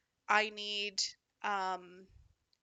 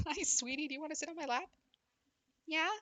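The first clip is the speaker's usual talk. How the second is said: higher coo to a dog; pet talk; 7 semitones higher, faster than usual. Real, not acted. Asking her dog onto her lap in a coo. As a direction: invite the dog up sweetly.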